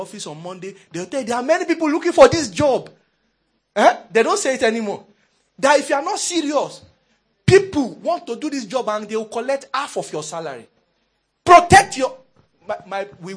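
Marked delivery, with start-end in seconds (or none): 1.3-2.9 loud, high boss's warning; threatening warning. Acted. Bosses to staff on Monday, loud threatening warning. 5.6-12.2 forceful, raised boss's lecture; threatening warning. Acted. Bosses to staff fearing for jobs, forceful threat.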